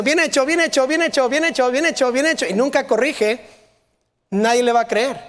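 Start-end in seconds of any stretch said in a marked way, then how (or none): 0.0-2.5 higher, singsong repeated praise; imitating automatic, empty praise; mock nonstop praise. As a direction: parrot the compliment over and over. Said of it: "si solo está diciendo".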